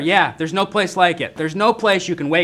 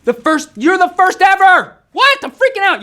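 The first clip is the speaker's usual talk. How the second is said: high, loud excited squeal; thrilled it's the very first time; freaking out with excitement; 14 semitones higher, 8 dB louder than usual. Real, not acted. Host thrilled at first live show.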